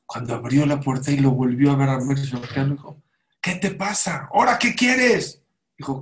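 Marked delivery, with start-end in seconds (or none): 3.4-5.4 irritated, much higher and louder at the door; exasperated rich miser; annoyed miser. Retold or imitated. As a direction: snap it at the unwelcome visitor. Miser opens the door again.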